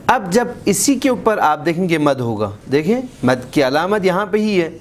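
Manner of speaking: ordinary delivery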